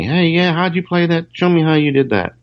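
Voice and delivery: lightly acted curious older guitarist. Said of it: "asking me".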